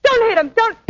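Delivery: high, loud cry begging him to stop; frightened plea. Acted. Woman begging her old boyfriend not to hit her partner, loud and scared. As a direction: scream at him to hold the punch.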